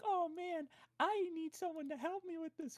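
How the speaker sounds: high, plaintive damsel voice; put-on high helpless sigh; mock helplessness. Acting a helpless woman.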